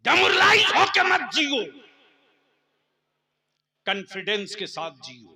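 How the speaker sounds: high booming exhortation; booming political rally speech. Real, not acted. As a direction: rouse the crowd, loud. Exhorting crowd.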